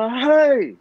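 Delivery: put-on high, loud snap at a crying child; retold sharp shout. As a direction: bark "Hai" high and loud to stop him. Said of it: "Saya kata". (jerkah "Hai" dengan kuat dan tinggi)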